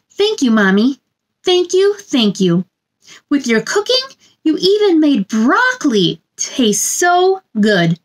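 gentle sing-song picture book reading; storybook read-aloud. A narrator's reading. Reading a picture book to children, sing-song storybook voice. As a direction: read it like bedtime story time for little ones.

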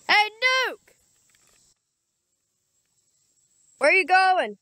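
high call across the yard; calling out to dog. Real, not acted. Kid calling to his dog across the yard, high call. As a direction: call the dog over from afar.

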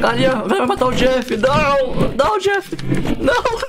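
high frantic gamer yelling; panicking gamer. Real, not acted. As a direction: yell in real panic as friends die. Chicken attack, friend dying.

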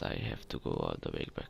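low, fast grumbling mutter; low grumble. Acted. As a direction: drop low and rush it out grumpily. Repeats line in low voice.

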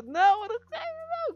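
loud, high mock-desperate denial; pleading denial. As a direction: protest your innocence loudly. Denies cheating to jealous girlfriend. (negando aos gritos)

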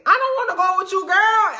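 high, fast, laughing brush-off; squeaky high refusal. Retold or imitated. Refusing a ride from Ebony, high and fast. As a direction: squeal it out like you're dodging her. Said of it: "I was like".

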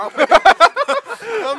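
shrieking burst of laughter; loud high laugh. Real, not acted. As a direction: crack up loudly. Laughing at fake injury.

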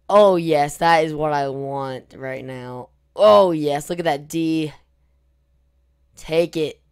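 loud, gleeful gaming hype; excited cheer. Real, not acted. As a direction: cheer the win loudly. Gamer cheering his attack.

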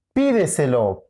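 "Pídeselo" stressed as PI-de-se-lo; stress on first syllable. Shown as a demonstration. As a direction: lean on the PI of "Pídeselo". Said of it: "Es pídeselo". («Pídeselo» con acento en la primera: PÍ-de-se-lo)

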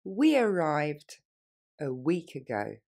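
we arrived drawn out word by word; very slowly. Shown as a demonstration. Teacher modelling for students, very slow. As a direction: stretch it out for the learner. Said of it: "Plus lentement".

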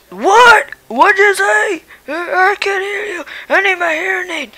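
cranky, hard-of-hearing grandpa voice; a deaf old dad barking back; grumpy old man. Acted.

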